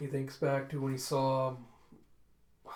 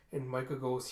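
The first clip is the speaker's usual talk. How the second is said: usual speaking voice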